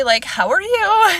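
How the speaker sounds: high, bright friendly greeting; chirpy fake-friendly hello; overly friendly. To the doctor, putting on an overly friendly voice. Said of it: "really friendly".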